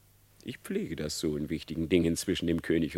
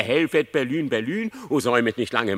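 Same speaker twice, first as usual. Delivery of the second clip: mock cry for help; 4 semitones higher, 6 dB louder than usual. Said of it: "in der Verwirrung rief er".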